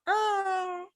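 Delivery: very high yowling ah; mock cat screech. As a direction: squeal like a cat caught in a window. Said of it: "Tonkinese cat getting his tail caught".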